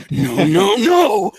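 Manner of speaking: a rasped Rorschach take on the repeated no. Shown as a demonstration. Voice actor showing the animator his Rorschach, rasped. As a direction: growl the no's out like the grim vigilante. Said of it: "oh, you mean this?".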